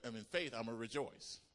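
ordinary talk

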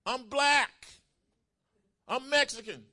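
very high, full-force hollering; shouted excuses. Acted. Believer shouts back to the Lord, hollering. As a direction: shout each excuse as its own outburst. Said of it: "we can't shout back to the Lord".

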